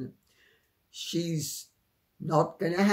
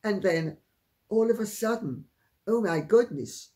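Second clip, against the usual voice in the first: normal talking voice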